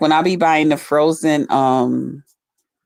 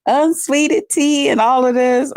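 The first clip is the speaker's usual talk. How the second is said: much higher, slow mocking disbelief; imitating Buddy Lee; 10 semitones higher than usual. Friend teasing her about unsweetened tea, high slow mock voice. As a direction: stretch out his fake outrage, up high. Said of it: "And he was like".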